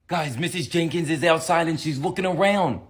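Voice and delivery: ordinary talk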